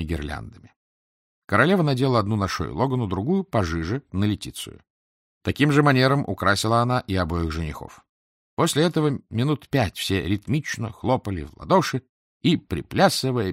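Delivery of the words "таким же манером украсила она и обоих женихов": ordinary delivery, nothing marked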